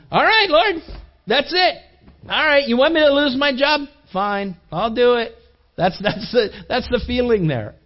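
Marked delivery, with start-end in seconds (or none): 0.1-5.3 much higher, exasperated giving in; grudging surrender. Retold or imitated. Believer grudgingly answering the Lord's call, exasperated and higher. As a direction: throw up your hands and agree.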